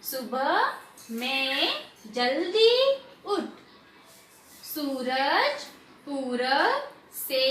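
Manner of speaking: very slow reading, long held vowels and pauses; slow read-along. Shown as a demonstration. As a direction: lead pupils word by word, stretching every vowel. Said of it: "धीरे धीरे पढ़ो". (साथ-साथ पढ़वाते हुए, बहुत धीरे और खींचकर)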